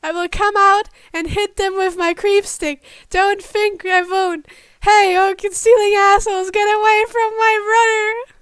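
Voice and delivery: high, loud little sister threatening; small girl shouting. Acted. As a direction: yell like a fierce little sister. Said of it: "a small female voice was now shouting".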